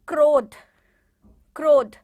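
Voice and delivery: क्रोध very loud, र joined to क; loud pronunciation demo. Shown as a demonstration. Teacher loudly models क्रोध for class. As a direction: model क्रोध loudly, क्र as one cluster. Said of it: "र की ध्वनि आ रही है".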